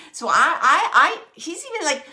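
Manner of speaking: ordinary talk, nothing marked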